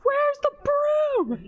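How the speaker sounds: high, slow, drawn-out call; high slow ghostly call. Acted. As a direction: stretch it out high and eerie. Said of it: "one of the other broom calls".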